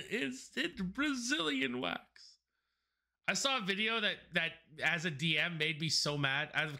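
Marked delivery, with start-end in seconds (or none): 0.1-2.0 laughing repeat. Acted. Repeats the joke.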